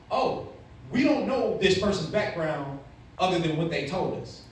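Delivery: ordinary delivery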